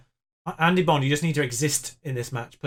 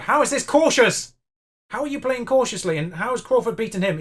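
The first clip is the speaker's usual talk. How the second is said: much higher and louder, disbelieving; exasperated outrage; 7 semitones higher, 5 dB louder than usual. Real, not acted. Manager yelling at players who ignore cautious orders. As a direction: shout at the screen in disbelief. Furious at his team's play.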